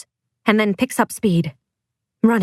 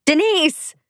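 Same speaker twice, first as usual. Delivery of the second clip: high, drawn cry of a name; screaming; 8 semitones higher than usual. A narrator's reading. Parent chasing the kidnappers' car, screaming. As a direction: scream the name, pitch raised. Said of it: "I scream one more time".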